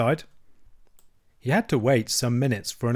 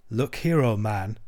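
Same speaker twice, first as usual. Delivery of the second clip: plain reading voice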